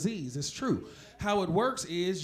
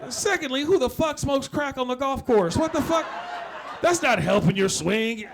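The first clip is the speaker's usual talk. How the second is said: indignant; 5 semitones higher, 7 dB louder than usual. Acted. Indignant rant at golfer.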